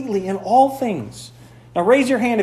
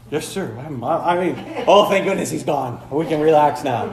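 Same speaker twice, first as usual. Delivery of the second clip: nervous then relieved; 3 semitones lower than usual. Retold or imitated. Living with the Apostle Paul, nervous then relieved. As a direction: snap to attention, then relax once he leaves. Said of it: "I'd be all".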